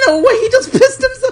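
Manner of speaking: high, loud words squeezed through laughter; laughing hard. Real, not acted. Amazed at a friend wetting himself, laughing hard. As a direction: gasp it out mid-laugh.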